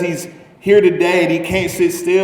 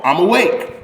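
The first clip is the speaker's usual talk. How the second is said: normal talking voice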